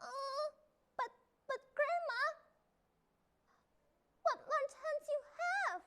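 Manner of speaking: put-on a scared little girl asking; high timid child voice. A narrator's reading. Child questions the wolf.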